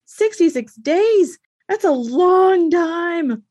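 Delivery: high, loud and drawn-out slow; mock dismay. Host balks at sixty-six days, in high, slow mock dismay. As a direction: exaggerate the shock at how long it takes. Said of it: "I'm like".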